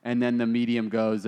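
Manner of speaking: ordinary delivery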